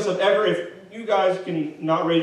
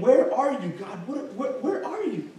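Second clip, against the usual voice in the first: high, whining plea with a laugh; high mock whine of where are you God; whiny complaint to God; 6 semitones higher than usual. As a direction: wail it like a fed-up child asking God. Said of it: "That's essentially what he's saying".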